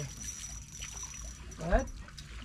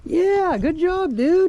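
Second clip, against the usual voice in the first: excited praise; 9 semitones higher, 4 dB louder, much slower than usual. Real, not acted. Son lands a fish.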